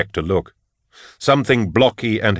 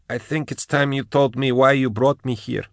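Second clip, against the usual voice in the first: higher, intense character voice; Ravener speaking through Kara; 5 semitones higher than usual. Acted. Ravener asks his mentor why he was summoned, intently. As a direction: press the mentor for answers, pitched up.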